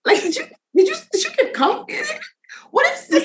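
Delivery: high, laughing incredulous questions; laughing disbelief. Pretend. Cohost pretending to find coffee in a bag, high and laughing. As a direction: play a stunned official, giggling.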